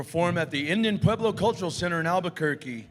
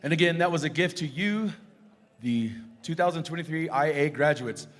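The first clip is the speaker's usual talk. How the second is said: ordinary delivery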